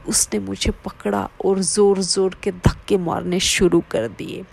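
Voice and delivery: ordinary delivery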